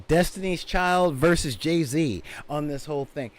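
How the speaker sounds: ordinary talk, nothing marked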